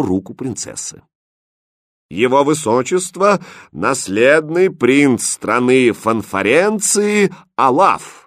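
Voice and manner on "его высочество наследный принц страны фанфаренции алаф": loud, high, stately herald; solemn proclamation. Acted. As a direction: announce it grandly, drawing out the words. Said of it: "торжественно объявил гофмейстер". (торжественно провозглашая)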